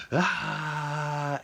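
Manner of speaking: high, drawn-out mock scream; imitated scream. Freddy with dynamite in his chest, imitated scream. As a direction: cry out in mock agony, held long. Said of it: "and he's like".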